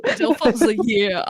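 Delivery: giggling through it; laughing. Real, not acted. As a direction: laugh while saying it. Laughing echo.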